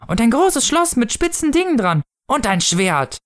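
put-on high child's voice; eager child. A narrator's reading. Child seizing the chance to wish, high eager voice. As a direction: jump in with a high, eager demand. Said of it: "antwortete es schnell".

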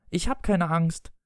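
put-on high, slow boy's voice; defiant child. Boy insists to his father, defiant child voice.

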